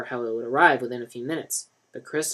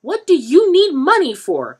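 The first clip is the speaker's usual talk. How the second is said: much higher, louder stern woman; stern mother; 12 dB louder than usual. A narrator's reading. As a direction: demand an answer. Mother questions.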